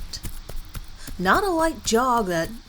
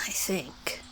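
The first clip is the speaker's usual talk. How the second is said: unsure little whisper to himself; whispered aside; 9 dB quieter than usual. Real, not acted. Narrator unsure of the month, whispering. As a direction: drop to a whisper, doubting the date.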